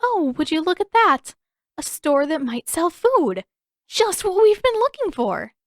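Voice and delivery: high, loud hammed-up innocence; mischievous fake surprise. A narrator's reading. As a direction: overplay the surprise with a wink. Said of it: "Giving Aang a mischievous grin".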